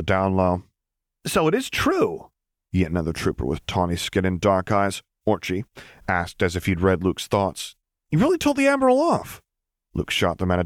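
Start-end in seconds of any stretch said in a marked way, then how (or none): 1.3-2.3 high, slow amazed question; eager disbelief. A narrator's reading. Trooper asking Luke if the rumour is true, slow and amazed. 8.1-9.4 much higher, excited disbelief; amazed question. A narrator's reading. Trooper asking Luke about the Admiral, high and excited.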